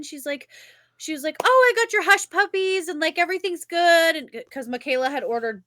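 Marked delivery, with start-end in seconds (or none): 1.4-4.2 high, sing-out sweet waitress voice; imitated syrupy waitress, pitched high; sweet server. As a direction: chirp it like an over-the-top nice server. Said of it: "so sweet".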